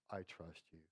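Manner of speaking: lightly acted, low and soft surrender; understated resigned trust. Preacher voicing disappointed Martha to Jesus, quietly resigned. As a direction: drop low and quiet, as if giving in.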